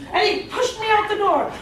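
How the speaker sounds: ordinary talk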